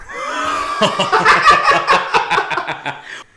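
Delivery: loud, high, airless laughter; high wheezing laugh. Real, not acted. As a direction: burst out laughing, breathless and high. Big laugh at the joke.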